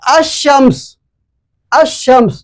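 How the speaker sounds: अश-शम्स with the sh doubled, no लाम; assimilated al. Shown as a demonstration. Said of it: "शीन आपके इस list में नहीं आ रहा है".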